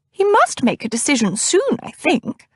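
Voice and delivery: put-on high, fussy older lady voice; fussy older cousin. A narrator's reading. Narrator voices Cousin Batilda.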